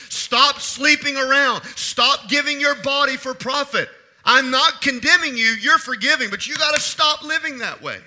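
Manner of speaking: loud, high, commanding sermon voice; urgent preaching. Real, not acted. Preacher voicing Jesus to the forgiven woman, urgent commands. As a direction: press each command on the listener.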